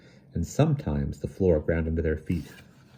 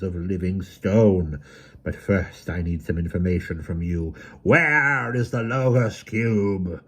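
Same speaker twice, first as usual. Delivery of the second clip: slow, menacing villain voice; 5 semitones higher, 4 dB louder than usual. A narrator's reading. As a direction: gloat over them slowly. Autarch villain speaks.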